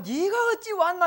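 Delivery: high, loud startled king. Acted. Preacher voicing the king who sees Esther, high and loud. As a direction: call out like a surprised ruler.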